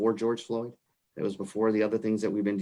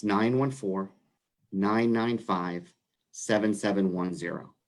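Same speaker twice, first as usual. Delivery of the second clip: phone number read out slowly; slow, careful dictation; slower than usual. Real, not acted. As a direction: give each group of digits room, pausing between. Repeats number after being too fast.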